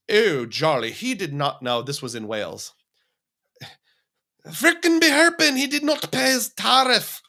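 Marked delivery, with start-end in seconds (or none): 0.1-1.8 meter maid in a badly faked Celtic accent; shaky Celtic accent. Welsh meter maid checking the machine, mock Celtic accent. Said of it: "my Celtic accent isn't working this morning". 4.4-7.2 high, loud hammed-up Scottish indignation; badly faked Scottish, pitched way up and loud; mock Scottish accent. Outraged meter maid over an unpaid tariff, mock Scottish accent. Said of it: "That was more Scottish".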